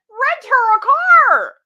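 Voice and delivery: high, loud, slow yelled retort; outraged shout. Real, not acted. Retorting to the mother keeping the car, shouting slowly. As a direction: shout it in disbelief.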